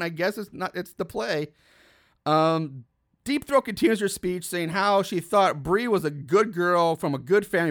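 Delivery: ordinary talk, nothing marked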